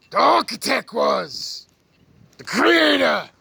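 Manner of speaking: slow booming reveal of the creator; dramatic reveal. Real, not acted. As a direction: announce it like a big reveal.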